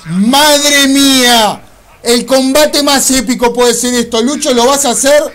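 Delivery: loud, very slow opening exclamation; hyped yelling. Real, not acted. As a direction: yell it out, pumped for the fight. Hyped gamer starting big fight.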